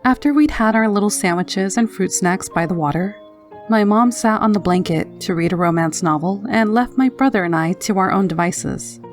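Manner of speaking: plain reading voice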